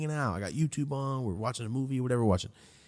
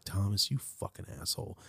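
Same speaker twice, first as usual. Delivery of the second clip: inner voice; 2 semitones lower, 10 dB quieter than usual. Retold or imitated. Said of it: "In my head, I'm saying".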